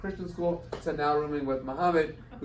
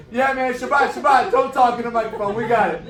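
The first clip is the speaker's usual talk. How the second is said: much higher and louder, a laughing yell from the stands; fan shouting; 10 semitones higher, 13 dB louder than usual. Retold or imitated. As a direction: cheer him on across the arena, half laughing. Crowd chanting support.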